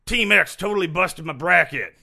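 loud mock complaint; hammed-up griping coworker; complaining office whiner. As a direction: whine about it to the whole office. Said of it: "Will you complain to everyone".

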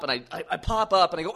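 ordinary talk, nothing marked

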